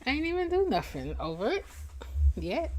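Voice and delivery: high, quick mock innocence; high playful protest. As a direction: squeal out the denial. Playful denial, higher and faster.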